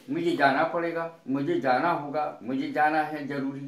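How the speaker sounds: ordinary delivery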